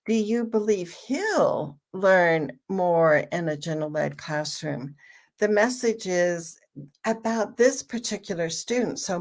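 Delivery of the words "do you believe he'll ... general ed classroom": single out he'll with a strong peak; stress on HE'LL. Shown as a demonstration. Parent asks about this particular student, stress on he'll. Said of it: "put the emphasis on the word he'll".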